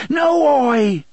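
high, loud desperate scream of no. A narrator's reading. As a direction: shriek it in terror. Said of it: "Jake screamed".

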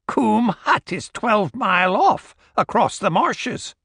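put-on high old crone voice; old woman voice. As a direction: voice a suspicious old Scots woman. Old woman answers stranger.

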